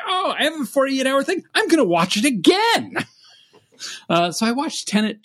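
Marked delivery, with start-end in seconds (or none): 0.0-3.0 high-pitched, mock-eager resolve; playfully hyped announcement to yourself; excited self-quote. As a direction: retell it like a giddy decision, pitched way up. Excited resolve to rewatch, retold.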